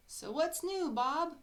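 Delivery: high, loud friendly drawl; rancher's greeting. Acted. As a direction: call out to the barber. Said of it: "Rancher Hicks asked".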